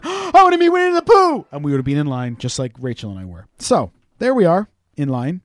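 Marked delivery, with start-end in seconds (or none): 0.0-1.5 excited squeal. Pretend. Imagined excited fan at Disney.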